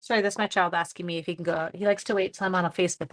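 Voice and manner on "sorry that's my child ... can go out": ordinary talk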